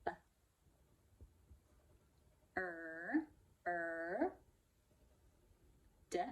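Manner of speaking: B, er, d sounded out slowly, one sound at a time. Shown as a demonstration. Teacher sounding out bird for young readers, slow and separate. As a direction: stretch each sound of bird apart. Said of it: "bird, bird".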